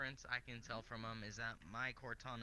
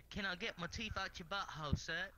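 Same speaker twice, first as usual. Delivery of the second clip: mock polite Englishman; 8 semitones higher, 6 dB louder than usual. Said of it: "Just like".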